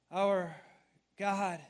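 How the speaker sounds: ordinary delivery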